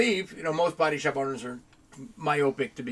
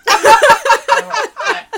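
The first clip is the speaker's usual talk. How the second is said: laughing; 15 dB louder, much slower than usual. Real, not acted. Laughing at the memory.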